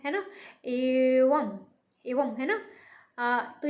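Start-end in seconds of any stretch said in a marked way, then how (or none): 0.6-1.6 एवं drawn out very slowly with nasal end; slow nasal demo. Shown as a demonstration. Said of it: "व के साथ में मैं क्यों बोल रहे हैं". (एवं को धीरे, अनुनासिक बोलें)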